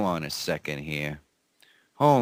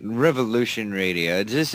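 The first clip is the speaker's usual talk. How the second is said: usual speaking voice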